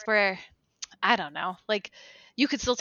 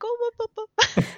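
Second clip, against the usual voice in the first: high, squeaky "Oh, boo, boo, boo."; exaggerated high "Oh, boo, boo, boo" mocking Macbeth; mock pity; 13 semitones higher than usual. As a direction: coo "boo, boo, boo" in a high, teasing baby voice. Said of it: "I'm like".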